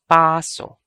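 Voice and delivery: Paso as a strong PAA-so; long first syllable in Paso. Shown as a demonstration. Teacher repeats the word for pacing, Paso with long, loud PAA. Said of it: "Paso means to walk around".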